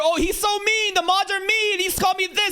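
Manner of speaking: hammed-up whining about mean mods; mock crybaby whine. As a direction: tattle like a hurt child, mocking. Mocking whiny drama streamers.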